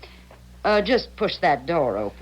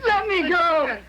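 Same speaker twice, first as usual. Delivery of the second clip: high, desperate cry to be let go; frightened cry; 11 semitones higher than usual. Acted. Grace struggling in Ernie's grip, crying out high. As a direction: pull away and cry out, higher.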